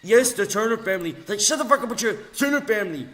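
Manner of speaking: shouting ranting tirade; loud angry rant. Real, not acted. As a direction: yell it, fired up. Ranting loudly at troll.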